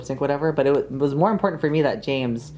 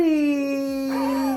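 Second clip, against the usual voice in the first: excited gasp; 10 semitones higher than usual. Acted. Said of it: "we're gonna go".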